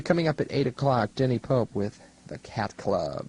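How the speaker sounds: normal talking voice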